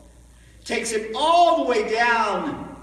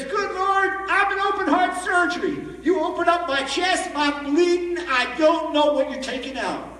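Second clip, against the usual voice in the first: crying, arguing prayer; 7 semitones higher than usual. Retold or imitated. Barmaid pleads with God after reading, higher, tearful arguing. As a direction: argue with God through tears. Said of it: "she's crying, and she's arguing with the heavens".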